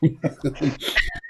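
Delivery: short soft laugh; a quiet real laugh that breaks out. Real, not acted. Medium laughs as spirits bring laughter. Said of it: "but I've got a laugh".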